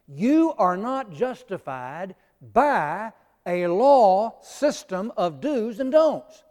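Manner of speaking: slow, weighty, every word pressed; slow emphatic statement. Real, not acted. Teacher driving home his point to the class, slow and emphatic. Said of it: "Get that".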